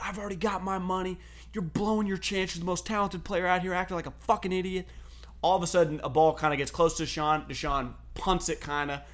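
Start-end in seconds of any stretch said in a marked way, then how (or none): 0.0-4.9 higher-pitched, lightly voiced coach rant; a hint of a coach chewing a player out; retold tirade. Coach berating a talented player, lightly acted mock tirade. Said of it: "Deuce then goes on a tirade".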